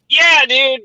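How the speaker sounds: loud, high excited cheer; excited fan. Retold or imitated. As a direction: blurt it like a starstruck fan. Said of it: "I didn't wanna be like".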